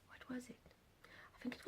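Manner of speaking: a soft whispered question to herself. Real, not acted. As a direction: whisper it while searching memory. Trying to recall arrival time.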